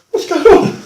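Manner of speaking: baffled by sudden chaos; acted disbelief; bewildered. Bewildered thought at sudden violence.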